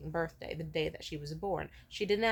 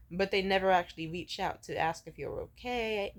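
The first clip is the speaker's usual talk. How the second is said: normal talking voice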